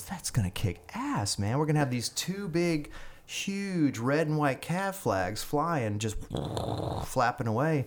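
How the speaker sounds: picture them flapping and grin; excited thought. Retold or imitated. Soldier dreaming about flags on the Humvee, excited.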